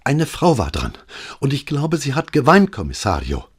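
put-on rattled young officer reporting to his boss; agitated sergeant. A narrator's reading. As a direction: blurt it all out in a rush of alarm. Agitated Alvise reporting call.

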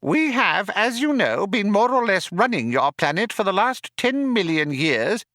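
high, squeaky, businesslike mouse; tiny mouse explaining the situation. A narrator's reading.

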